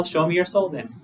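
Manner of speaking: usual speaking voice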